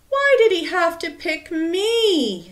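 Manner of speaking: loud, high-pitched wail of complaint; moaning. A narrator's reading. Stephen dreading his turn at the gong, loud moan. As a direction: moan it loudly, poor me. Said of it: "Stephen moaned".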